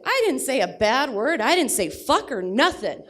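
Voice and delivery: mock-innocent child objecting; indignant kid. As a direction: protest like a caught little kid. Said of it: "And he said".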